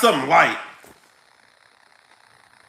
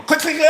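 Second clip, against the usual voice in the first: imitated lock clicks; 8 semitones higher than usual. Imitating car alarm locks.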